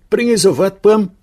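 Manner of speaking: high-pitched call from the waitress. A narrator's reading. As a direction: call it out brightly to the regulars. Waitress calls back to table. (Bedienung ruft hoch zurück)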